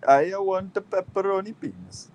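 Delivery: high, loud, hammed-up Italian customer; mock Italian accent. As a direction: order like a cartoon Italian. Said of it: "you come by the thing and you say".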